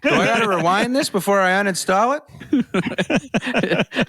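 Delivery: put-on slow, puzzled old-timer; boomer. Man jokes about rewinding games, slow old-timer voice. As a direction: play the confused boomer.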